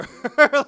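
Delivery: loud, high burst of laughter. Real, not acted. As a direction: crack up at your own joke.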